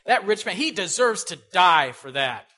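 angry verdict. Retold or imitated. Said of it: "David's angry".

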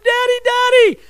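put-on high excited child's call; squeaky kid's voice calling daddy. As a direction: call out like a little boy running up. Child running to father.

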